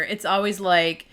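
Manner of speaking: ordinary talk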